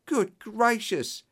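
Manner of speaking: high, drawn-out exclamation; shocked cry. A narrator's reading. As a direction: cry it out slowly, high-pitched and aghast. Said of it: "he cried".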